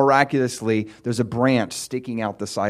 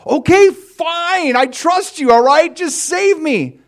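much higher and loud, giving in; exasperated yelling; 10 dB louder, slower than usual. Retold or imitated. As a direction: shout it, fed up and scared. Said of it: "And the guy's like".

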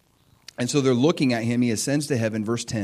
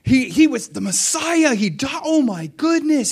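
high, loud, hammed-up amazement; excited realization; 10 semitones higher, 4 dB louder than usual. Disciples realizing he was the Messiah, excitedly amazed. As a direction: burst out as the truth dawns. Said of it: "They're excited".

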